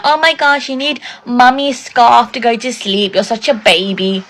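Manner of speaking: high start, slowing into a taunt; imitating mockery. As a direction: draw out the teasing of a toddler. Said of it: "they were making fun of him".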